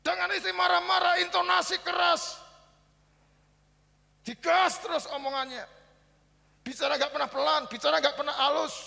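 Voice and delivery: high, loud preaching about shouting at home; fiery preaching. Acted. Preacher warns husbands about angry homes, loud and fiery. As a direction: thunder the warning at the congregation.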